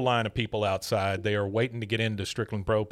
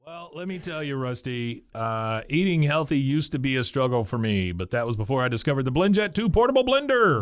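ad read; 3 semitones higher than usual. Pretend. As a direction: sell it like a TV spot. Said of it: "our first commercial break".

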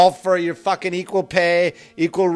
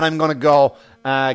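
ordinary delivery

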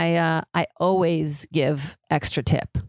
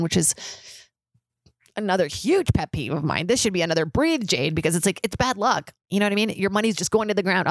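ordinary talk, nothing marked

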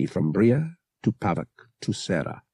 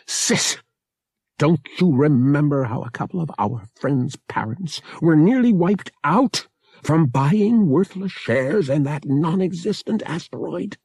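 high, heated reminder; exasperated; 7 semitones higher, 3 dB louder than usual. A narrator's reading. Pavak to his sister about the asteroid, exasperated. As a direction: press the point in exasperation. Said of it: "threw up his arms in exasperation".

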